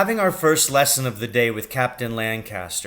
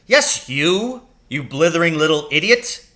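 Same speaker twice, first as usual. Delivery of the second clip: loud, high scolding; contemptuous bellow at a pupil; angry berating; 6 semitones higher, 8 dB louder than usual. Acted. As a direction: tear into the boy.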